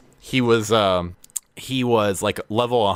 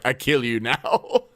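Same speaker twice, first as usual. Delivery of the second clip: slow, laughing menace; a lightly voiced comic villain; mock threat; 3 semitones higher than usual. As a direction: player switching to a threat. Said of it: "He's like".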